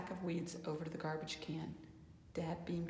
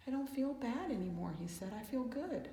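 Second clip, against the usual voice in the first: happy; 6 semitones higher, 3 dB louder than usual. A narrator's reading. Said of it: "He smiled".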